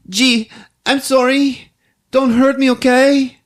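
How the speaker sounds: high, slow put-on female voice; pleading woman. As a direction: plead in a high mock little voice. Woman pleading with angry driver.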